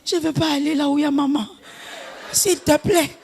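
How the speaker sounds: high, laughing mock plea; preacher imitating a weepy girl begging her father; pleading crying girl. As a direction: beg Daddy in a hammed-up whimper. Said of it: "Il dit".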